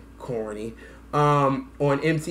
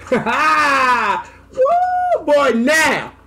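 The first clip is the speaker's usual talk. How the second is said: loud high laughing shout; laughing woo; 10 semitones higher, 6 dB louder, much slower than usual. Real, not acted. Fan cracking up at the lyrics, loud laughing whoop.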